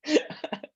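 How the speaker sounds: real high-pitched laugh at her own joke; real laugh after begging for videos; high burst of laughter. Real, not acted. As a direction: crack up at yourself. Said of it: "I cracked myself".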